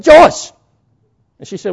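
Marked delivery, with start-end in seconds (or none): 0.0-0.5 high, loud sharp call; shouting her name. Retold or imitated. Brother cutting through his sister's panic, loud shout.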